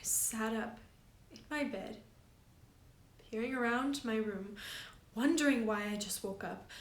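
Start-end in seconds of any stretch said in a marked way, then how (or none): none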